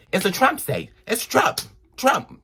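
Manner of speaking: rising mock emphasis. Mocking Trump emphasis.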